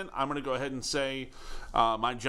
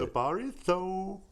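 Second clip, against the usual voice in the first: high, slow put-on character voice; drawn-out comic impression; Fletch Lives voice; 7 semitones higher, slower than usual. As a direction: slip into a goofy movie-character voice. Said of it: "that was a Fletch Lives voice".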